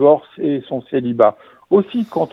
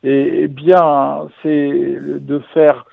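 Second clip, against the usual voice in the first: slow dramatic build-up; much slower than usual. Acted. Slow dramatic answer to own question.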